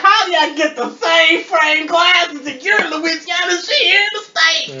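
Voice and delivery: high, loud mock disbelief; hammed-up astonished shouting; incredulous outburst. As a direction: shout it, baffled and near laughing. Retelling own baffled outburst, half laughing.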